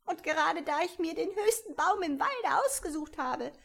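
high, put-upon bird voice, not listening to anyone; fussy pigeon. A narrator's reading. The pigeon complaining.

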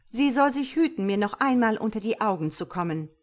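forceful, solemn verdict; stern pronouncement. Acted. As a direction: proclaim the banishment with full authority. God passes sentence on Luzifera.

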